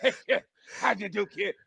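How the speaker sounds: put-on soft, breathless old man laughing through it; wheezy old trainer. Mocking Mickey from Rocky, soft breathless old-man voice. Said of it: "He go".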